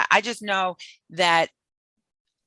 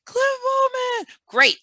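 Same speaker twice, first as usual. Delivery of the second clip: mock cheering; much slower than usual. Mocking the crowd's praise.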